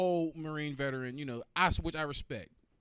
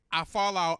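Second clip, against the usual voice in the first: usual speaking voice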